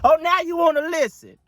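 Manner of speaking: high, loud scoffing told-you-so; sassy told-you-so. Real, not acted. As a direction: rub it in with sass. Sassy remark on followers.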